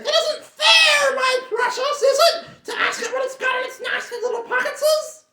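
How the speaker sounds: sulky creature wheedling its precious; whining Gollum. Acted. Gollum complaining.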